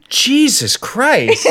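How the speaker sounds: high, loud, drawn-out exclamation; shocked exclamation. Real, not acted. Co-host hears how much sugar it was, shocked outburst. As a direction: exclaim in real astonishment, stretching it out.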